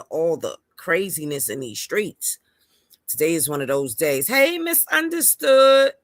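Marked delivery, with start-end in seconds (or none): 4.3-5.9 loud, high, drawn-out hello; excited greeting. Real, not acted. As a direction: call out a happy welcome. Greeting a viewer on live.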